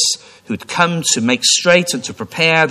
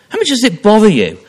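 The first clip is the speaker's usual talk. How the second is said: fast, louder challenge; urgent preaching; 7 semitones higher, 6 dB louder, faster than usual. Acted. Preacher challenging the congregation, quick and loud. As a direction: press the question on them.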